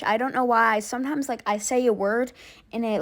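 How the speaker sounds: ordinary delivery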